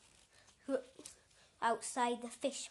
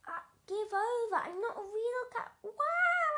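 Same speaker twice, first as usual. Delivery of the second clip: much higher, bright indignant protest; excited protest; 12 semitones higher, 5 dB louder than usual. Acted. Boy protests to the fishmonger, high and excited.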